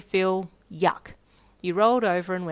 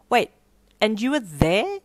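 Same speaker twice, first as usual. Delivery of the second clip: jaw-dropped disbelief; 3 semitones higher, 7 dB louder than usual. Acted. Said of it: "her jaw dropping open".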